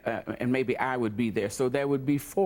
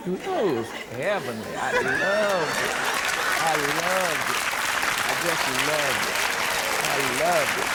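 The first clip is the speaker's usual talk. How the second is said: louder, savouring each repeat of the words; blissful delight; 2 semitones higher, 4 dB louder than usual. Real, not acted. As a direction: relive the joy out loud, fondly. Blissful memory of glamorous days.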